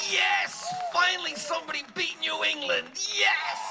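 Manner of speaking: loud, high fan cheer; jubilant shout. Retold or imitated. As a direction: cheer like your team just won. Retelling fans' touchdown joy.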